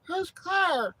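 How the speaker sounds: very high squeaky mock voice; dazed friend's voice. Knocked-out friend forgets who she is, high dazed squeak. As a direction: squeak it out, clueless after a beating. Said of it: "She'd be like".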